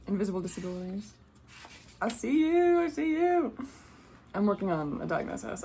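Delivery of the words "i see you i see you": much higher, gently crooning I see you; high, affectionate welcome; high tender greeting. Real, not acted. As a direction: coo it like greeting someone dear. Tender address to the reader.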